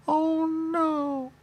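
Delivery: normal talking voice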